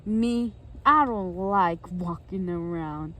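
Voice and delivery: slow, low grump voice; low, heavy, dragging complaint; Grouchy. Acted. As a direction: grumble it slowly and low. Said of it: "He said".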